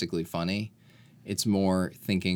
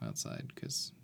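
whispering; 11 semitones lower, 14 dB quieter than usual. Real, not acted. Sudden unvoiced aside.

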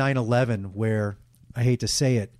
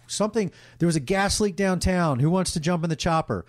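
higher, urgent call across the room; calling out in the newsroom; 7 semitones higher than usual. Retold or imitated. Newsroom producer calling for someone to jump in the chopper, higher and urgent. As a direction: pitch it up like a producer shouting for a volunteer.

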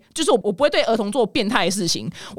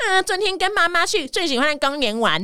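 put-on high, teasing childish sing-song; mocking imitation; 9 semitones higher than usual. High schooler mocking a classmate's post, high childish taunt. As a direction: mimic him to make fun. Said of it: "你一定会学他的口吻说".

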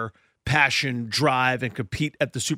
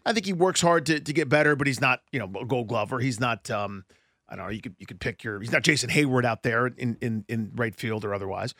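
ordinary delivery

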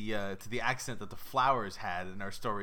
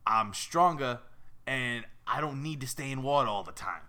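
loud, boastful New York goldfish; raspy New Yorker; 4 dB louder than usual. Acted. Fish bragging to the girl, raspy New York voice. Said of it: "They all sound like raspy New Yorkers".

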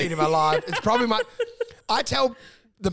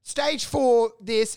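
drawn out, slow, mock grave; slow imitation; 3 semitones higher, much slower than usual. Imitating the comic telling about his dying dad, slowly. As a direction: stretch each word out like a gloomy confession. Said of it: "He'll say".

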